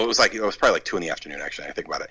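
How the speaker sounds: ordinary talk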